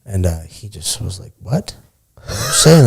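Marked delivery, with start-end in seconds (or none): 1.4-2.9 incredulous. Retold or imitated. Tyson reacting to the Portland joke.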